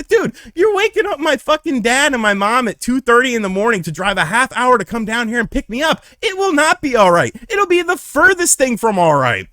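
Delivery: put-on teen despairing at his friend about his parents; frantic despair. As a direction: wail it up high, at the end of your rope. Breakdown at magistrate office.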